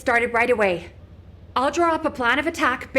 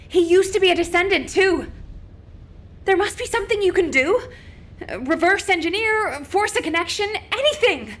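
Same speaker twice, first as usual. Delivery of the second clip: desperate pleading; 6 semitones higher than usual. Acted. Begging the guide to save Alpha.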